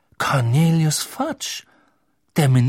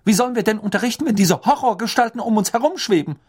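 much higher, sharp stern teacher; 10 semitones higher, 4 dB louder, faster than usual. Acted. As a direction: cut in sharply and firmly. McGonagall continues sharply.

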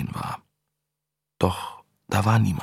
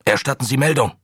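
barked order; 5 semitones higher, 4 dB louder than usual. Acted. Commander barking over radio.

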